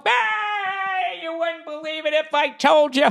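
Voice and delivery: put-on a cranky old man bragging about the good old days; old grandpa. Future grandpa telling his grandkids about frozen ponds, a high creaky old-man voice. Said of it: "And I'll be like".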